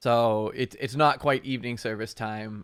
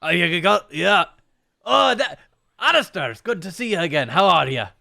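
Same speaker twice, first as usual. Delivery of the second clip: put-on high, loud old priest greeting; 8 semitones higher, 13 dB louder than usual. Old priest welcoming Alistair back, high put-on voice. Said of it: "to get the voice right".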